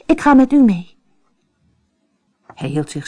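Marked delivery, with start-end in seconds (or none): 0.1-1.0 raised, insistent cry; calling out. A narrator's reading. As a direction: call it out higher and louder, refusing to be left. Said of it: "riep Anders". (vastberaden uitroepen)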